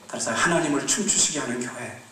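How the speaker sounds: measured, emphatic exhortation; preaching. Acted. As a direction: proclaim each line as a call. Sermon exhortation.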